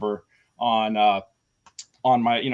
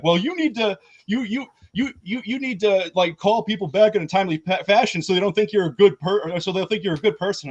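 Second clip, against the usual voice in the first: much higher, stammering sanctimonious caller; mock whiny lecture; 7 semitones higher, 3 dB louder than usual. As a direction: mimic the caller scolding you, higher. Mocking the caller's parting lecture.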